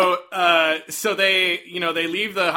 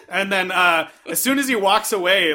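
ordinary talk, nothing marked

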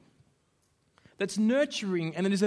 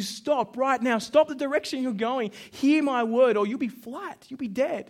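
raised, louder, higher fatherly command; strong urgent warning; 6 semitones higher, 6 dB louder than usual. Retold or imitated. Father warning his son about the car, strong urging. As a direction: urge him hard, voice pitched up and forceful. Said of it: "say something like that strong".

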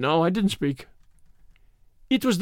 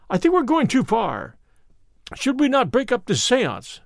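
higher-pitched urgent protest; raised pitch, mildly alarmed objection; crying out; 6 semitones higher than usual. A narrator's reading. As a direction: call a halt, voice lifted. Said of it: "I cried".

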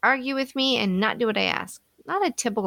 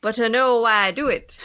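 amateur Irish drifting Southern; Irish accent slipping into Southern; 3 dB louder than usual. Acted. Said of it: "I keep going Southern".

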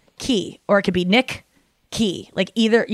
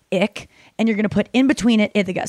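ordinary talk

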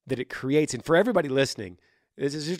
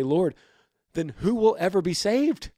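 higher, louder cry of dismay; alarmed disciples; 6 semitones higher, 6 dB louder than usual. Retold or imitated. Disciples asking Jesus in alarm, loud and high. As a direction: plead it out like shaken followers.

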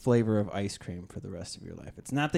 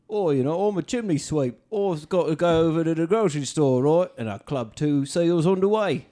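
a louder, higher Cockney chimney-sweep voice; hammed-up Cockney; 4 semitones higher, 5 dB louder than usual. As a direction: play it up like a cartoon chimney sweep. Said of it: "the only way I can consistently keep it".

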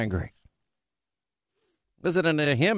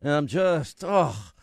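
languid whining about the hard life; drawling put-upon senator over music; McConnell voice. Retold or imitated. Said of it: "should I do that voice".

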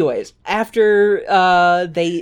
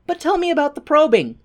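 much higher, pushy curiosity; hammed-up high penguin; eager Mumble; 11 semitones higher than usual. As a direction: press him like a nosy kid. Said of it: "Mumble is like".

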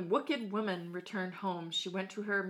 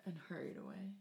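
whisper; 2 semitones lower, 12 dB quieter, faster than usual. A narrator's reading.

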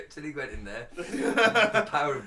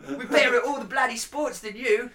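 mock Australian; 5 semitones higher, 4 dB louder than usual. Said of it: "Sorry, I'm full of shit accents today".

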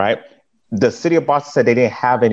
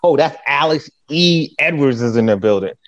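a touch higher, announcing him like a star; mock hype; 3 semitones higher than usual. Friends laughing as he walks in, mock hype. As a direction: tease him like a hype man. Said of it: "They used to laugh".

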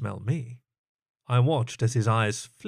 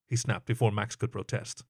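ordinary delivery

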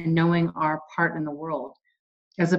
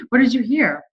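much higher sing-song question with a laugh; laughing pet talk; 6 semitones higher, 3 dB louder than usual. Retold or imitated. Owner cooing at the pet with a laugh, high sing-song. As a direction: coo at the pet, giggling.

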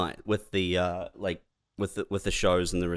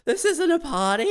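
much higher squeaky complaint; mock punter; 4 dB louder than usual. Disappointed punter at a comedy show, high squeaky complaint. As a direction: squeal it like a let-down audience member.

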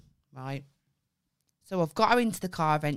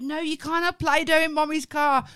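higher, louder telling off; scolding; 9 semitones higher, 7 dB louder than usual. Retold or imitated. Mum scolds her daughter about Play-Doh in the car. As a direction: scold the child firmly. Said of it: "I was like".

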